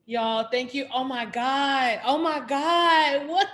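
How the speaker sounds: loud, high, drawn-out awe; overwhelmed gratitude. Real, not acted. Host thanking the featured poet after the reading, overwhelmed and loud. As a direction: wail it out, overcome by the poem.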